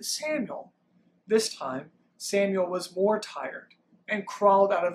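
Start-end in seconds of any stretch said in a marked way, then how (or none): 0.0-0.7 high call of the name; voice calling again. A narrator's reading. God calls the boy once more, high-pitched call. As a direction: call it out like a voice from afar. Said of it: "the voice called again".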